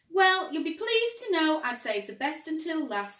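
loud, high proud announcement. A narrator's reading. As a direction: show off the big surprise. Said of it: "said Ted proudly".

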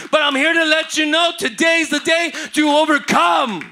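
loud, rising preacher's cry; full-voiced sermon climax; preaching at full force. Real, not acted. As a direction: preach it out to the whole church.

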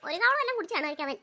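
normal talking voice

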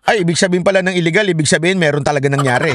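usual speaking voice

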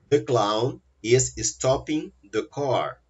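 slow, careful model sentence. Shown as a demonstration. Teacher modelling an English sentence slowly. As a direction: read it out slowly for the class. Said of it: "example sentence read slowly".

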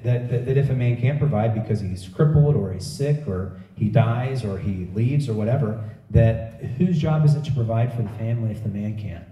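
normal talking voice

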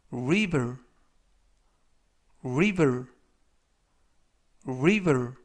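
"river" said clearly, stressed first syllable, falling; model pronunciation. Shown as a demonstration. Teacher showing learners how to say river, model pronunciation. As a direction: demonstrate "river" for learners, each alone and falling. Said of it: "이라고 발음하시면 되겠고요".

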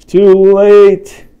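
loud, high burst after a gasp; dramatic gasp. Acted. Parent turning the page despite the child, loud and dramatic. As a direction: blurt it like doom has arrived.

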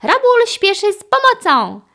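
high, loud puppy hero cry; loud, high-pitched rallying call; eager shout. A narrator's reading. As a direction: shout it as a keen rescue pup. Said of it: "krzyknął piesek".

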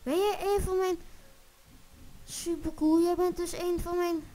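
thrilled to meet one of his own; excited. Acted. Excited to meet fellow Ector.

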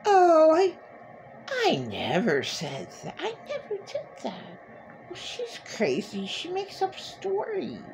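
high, then hushed mock denial; mocking abuser denial. Abuser denying everything to her, mocking sneer. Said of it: "these abusers have no memory of it".

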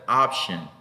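"Option" with an ah first vowel; option vowel demo. Shown as a demonstration. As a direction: model "Option" firmly for learners. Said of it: "esta otra es una A".

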